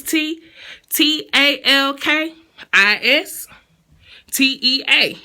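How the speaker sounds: letters spelled out slow and deliberate; spelling slowly. Shown as a demonstration. Vlogger spelling the email address for viewers, slow. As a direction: spell each letter carefully. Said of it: "I did the side thing 'cause I always stutter when I'm spelling".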